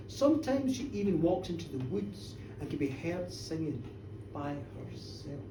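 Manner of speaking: low, slow, hushed accusation; ominous gossip. A narrator's reading. Village woman informing on Janet to minister, hushed and ominous. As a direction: let each damning detail sink in softly.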